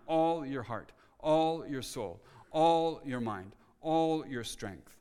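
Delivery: "all" stretched and stressed each time; slow, measured list, landing hard on every "all"; repeated stress on all. Shown as a demonstration. Preacher showing the repeated word in the verse, stress on every "all". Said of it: "the emphasis here is all, the word all is repeated each time".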